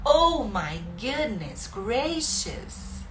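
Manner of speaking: a very slow oh my goodness gracious; drawn-out goodness gracious. Shown as a demonstration. Teacher showing easy English exclamations, stretched out. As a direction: savour it in theatrical mock shock. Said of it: "Isso tudo é barulhinho fácil".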